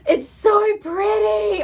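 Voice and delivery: high, slow, gushing; gasping delight. Real, not acted. Vlogger opening the sold-out lip scrub, high delighted coo. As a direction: gasp then coo over it.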